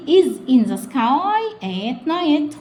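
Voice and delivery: very slow reading. Shown as a demonstration. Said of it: "very slow model".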